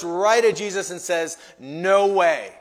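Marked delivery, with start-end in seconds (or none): 1.6-2.6 loud, flat-out refusal; self-confident refusal. Acted. Peter looking right at Jesus, defiantly self-confident. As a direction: refuse it firmly and loudly. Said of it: "They're dripping with self-confidence".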